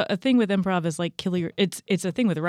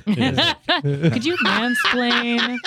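laughing; 7 semitones higher, 6 dB louder, much slower than usual. Acted. Slow laughing request.

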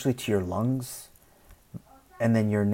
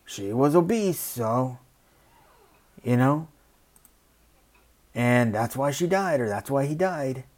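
mocking quote; 3 semitones higher than usual. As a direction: sneer out the excuse, pitched up. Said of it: "we're like".

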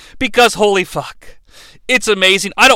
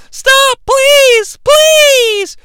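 high falsetto pleading; begging woman; 4 dB louder, much slower than usual. Retold or imitated.

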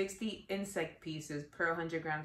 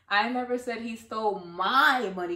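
my picked out, slow read; slow, leaning on my; stress on MY; 4 dB louder than usual. Shown as a demonstration. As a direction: lean into my. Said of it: "the words that you stress".

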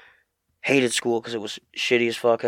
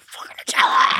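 breathy, hungry whisper; craving whisper; 5 dB louder than usual. Retold or imitated. A Nutella-addicted kid whispering his craving.

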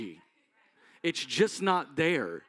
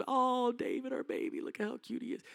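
put-on higher, softer, cooing over the baby; doting parents; 6 semitones higher, 8 dB quieter than usual. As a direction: coo like a proud mom. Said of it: "Mom and dad just loved him".

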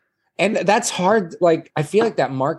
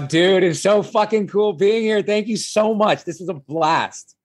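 overexcited, higher voice for Jacob; Jacob character voice; 7 semitones higher than usual. Acted. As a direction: gush like a too-enthusiastic YouTuber. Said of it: "[name], as the character".

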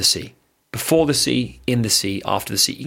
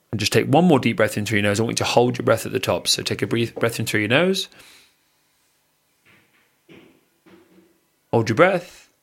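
guided breathing. Acted. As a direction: talk them through each breath, unhurried. Leads a breathing exercise.

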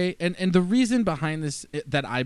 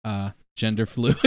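ordinary talk

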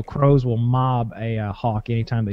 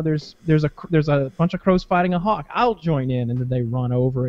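ordinary delivery

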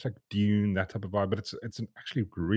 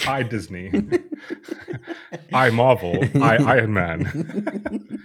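laughing mockery; 3 semitones higher, 6 dB louder, much slower than usual. Real, not acted. Mocking the CEO's product names.

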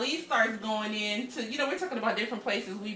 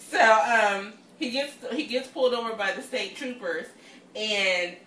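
usual speaking voice

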